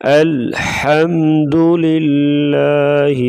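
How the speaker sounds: slow chanted "الحمد للہ", light ل; thin laam. Shown as a demonstration. Teacher models the correct reading, thin laam in "الحمد للہ". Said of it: "اس کو باریک پڑھیں گے".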